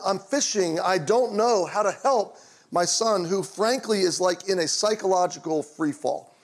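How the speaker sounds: usual speaking voice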